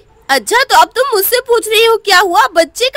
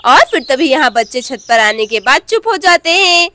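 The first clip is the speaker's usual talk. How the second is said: ordinary delivery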